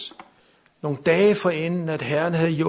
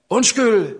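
raised, high retold shout; calling out; 6 semitones higher, 9 dB louder than usual. Preacher re-enacts shouting to the stranger, calling out. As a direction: call after someone walking away. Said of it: "så råbte jeg til ham".

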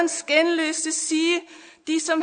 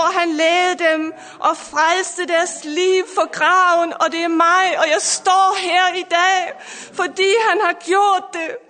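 building, louder sermon cadence over music; fervent preaching; 2 semitones higher, 4 dB louder than usual. Acted. Pastor preaching to listeners online, fervent and rising.

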